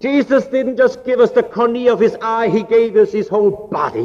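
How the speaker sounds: high, emphatic sermon delivery; impassioned preaching. Real, not acted. Preacher telling congregation of Christ's sacrifice, impassioned preaching.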